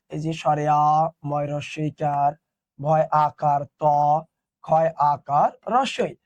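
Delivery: slow, low letter-by-letter naming; spelling out. Shown as a demonstration. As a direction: spell it out slowly as if writing it down. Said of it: "আমরা যখন লিখি". (ধীরে ধীরে বানান করে বলা)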